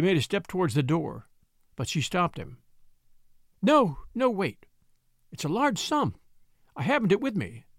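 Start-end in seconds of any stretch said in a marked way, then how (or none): 3.6-7.6 high, broken pleading; stammering. A narrator's reading. Widow stopping the man at the door, stammering. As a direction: beaten widow stumbling to stop him. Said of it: "she stammered".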